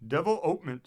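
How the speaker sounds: "Development" said firmly, leaning on the "ope"; emphatic, stress on OPE. A narrator's reading. President to foreign minister, emphatic "Development" accented on "ope". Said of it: "said the general with emphasis".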